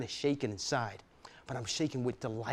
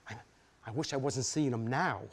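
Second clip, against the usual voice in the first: normal talking voice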